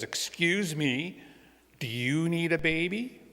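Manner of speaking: put-on slow, high little-girl voice; a small girl's hopeful question, drawn out; high and slow, rising hopefully at the end; little girl asking sweetly. Robin politely asks a stranger.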